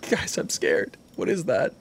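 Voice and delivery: unvoiced, hushed fearful repeat; mock scared. Telling the guys what is that, scared hush. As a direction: breathe out the fear in a near-whisper.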